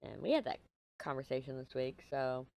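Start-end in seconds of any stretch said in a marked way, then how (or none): none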